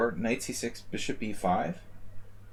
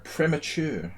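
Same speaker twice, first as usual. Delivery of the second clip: a stab at a British "Premature", higher pitch; British accent attempt; 6 semitones higher, slower than usual. Shown as a demonstration. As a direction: try the Brit way of saying "Premature". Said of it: "I can't say it like a Brit would say it".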